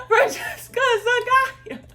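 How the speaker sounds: high, loud shout for help; desperate call. Retold or imitated. Calling her friends before the gate closes, loud shout. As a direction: scream for anyone to come.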